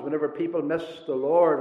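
ordinary delivery

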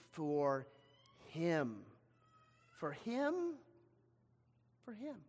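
very soft, halting disbelief; dripping disdain. Acted. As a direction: let contempt drip from each hushed word. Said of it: "you can almost hear the, the word dripping".

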